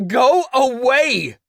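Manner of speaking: high, loud, slow scream. Acted. Caden screaming at his parents through the door. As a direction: scream them away from the door. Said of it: "Caden screamed".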